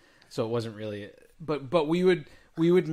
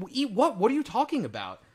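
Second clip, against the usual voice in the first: retold bafflement; 7 semitones higher than usual. Retold baffled reply to child.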